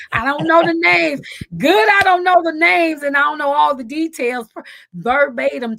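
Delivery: loud, high and keyed up; loud excited protest; excited outburst. Real, not acted. Excitedly saying she can't share details.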